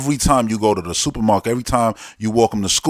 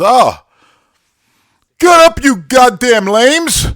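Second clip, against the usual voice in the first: high, slow, loud mock jeer; mocking Boromir; 12 semitones higher, 8 dB louder, much slower than usual. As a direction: jeer at them like a sitcom bully. Imagined joking Boromir.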